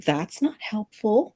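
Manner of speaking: drawn-out, put-out retort; exasperated retort. Retold or imitated. Woman scolds her own frozen legs, exasperated.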